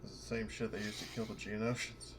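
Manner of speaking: ordinary delivery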